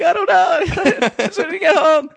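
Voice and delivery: high, loud helpless wail through laughter; laughing mock plea. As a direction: act out a flustered excuse while cracking up. His frantic reply to cop, laughing.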